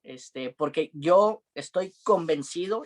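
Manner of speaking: ordinary delivery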